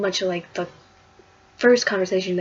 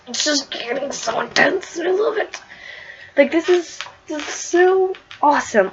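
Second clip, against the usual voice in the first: excited gushing; 9 semitones higher, 3 dB louder than usual. Real, not acted. Vlogger raving to viewers about the comic, excited. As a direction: voice jumps high and bright with delight.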